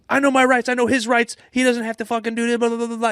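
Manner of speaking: high-pitched, rapid mock rant; popping off. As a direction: go off indignantly as the riled-up wife. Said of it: "they're popping off".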